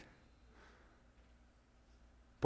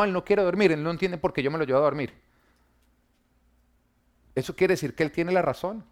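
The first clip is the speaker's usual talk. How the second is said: normal talking voice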